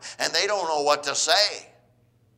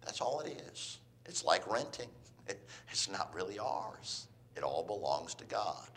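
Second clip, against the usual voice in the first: quiet aside; 7 semitones lower, 14 dB quieter than usual. Real, not acted. Quiet aside after loud point.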